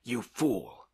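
scornful cry from a blustering guest; exclaimed insult. A narrator's reading. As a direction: exclaim it in exasperation. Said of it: "exclaimed Tarantiev".

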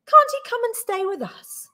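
eager pleading question. A narrator's reading. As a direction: beg hopefully, voice lifted high. Wife pleads for the bear.

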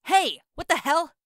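furious shout through a locked door; angry yelling. Acted. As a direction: bang on the door and yell. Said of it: "when I was yelling at Raccoon Eyes".

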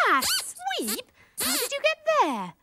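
high-pitched surprise; taken aback, pitch jumping up; startled. Acted. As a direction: react to someone popping up out of nowhere. Startled by Sweep appearing suddenly.

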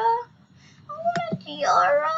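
mock-tearful high, drawn-out whine; whiny begging. Young player begging for a tiara in the game, whiny. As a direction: beg for it like a whining little kid.

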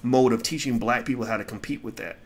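usual speaking voice

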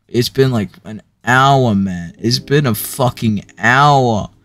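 loud, slow, angry rant; furious yelling. Real, not acted. Reader stuck an hour on jokes, furious yelling. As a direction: bellow your frustration. Complaining it's been an hour.

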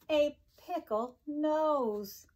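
plain reading voice

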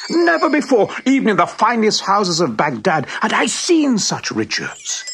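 awed wonder. A narrator's reading. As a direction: gasp at impossible riches. Awe at the diamonds.

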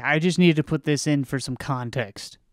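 normal talking voice

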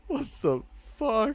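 high, slow squeal after a laugh; real incredulous laughter in the voice; laughing disbelief. Real, not acted. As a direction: gasp it out high, just after cracking up.

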